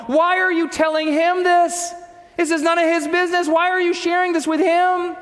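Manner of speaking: high-pitched mock yell at mom; retold screaming. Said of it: "starts screaming at her mom".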